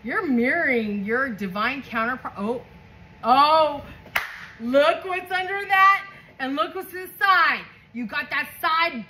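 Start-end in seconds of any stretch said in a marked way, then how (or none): none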